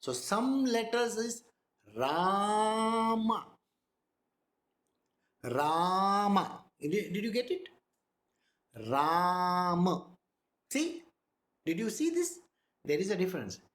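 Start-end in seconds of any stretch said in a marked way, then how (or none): 2.0-6.6 "Rama" with Ra held long, ma short; long first syllable. Shown as a demonstration. Teacher showing students the syllable lengths, Ra drawn out long. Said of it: "Ra is long". 8.9-10.0 "Ram" with the Ra held long and a short ma after. Shown as a demonstration. Teacher showing students the difference, Ra held long. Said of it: "It is Ram".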